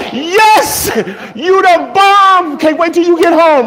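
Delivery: put-on high, loud, slow gushing girlfriend voice; squealing praise for a faithful man; delighted girlfriend. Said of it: "You like".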